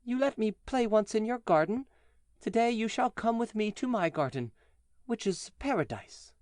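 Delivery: put-on child inviting the giant to paradise. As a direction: speak as the little child, high and gentle. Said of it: "said to him".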